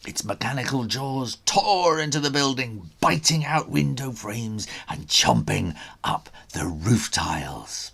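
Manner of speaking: usual speaking voice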